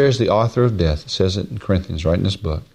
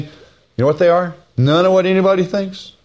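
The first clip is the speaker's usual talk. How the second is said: ordinary talk, nothing marked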